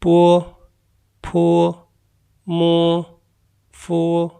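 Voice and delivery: "पो, फो, मो, फो" as separate clear syllables with pauses; Chinese initials drill. Shown as a demonstration. Teacher models "पो, फो, मो, फो" for students to repeat. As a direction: model "पो" and "फो" for learners to copy. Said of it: "तो आइए पढ़ते हैं".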